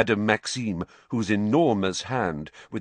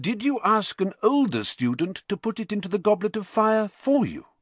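high-pitched, pressing question; probing headmaster; 13 semitones higher than usual. Acted. Dumbledore questioning Harry before the others, probing.

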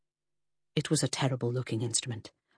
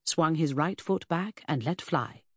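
ordinary delivery

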